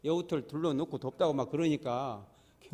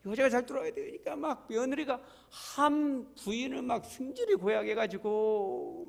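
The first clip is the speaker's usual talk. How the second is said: high, slow sing-song telling; higher drawn-out mock tone; 7 semitones higher, slower than usual. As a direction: tease about the difficult daughter-in-law. Mocking daughter-in-law telling.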